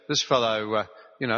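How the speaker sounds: ordinary talk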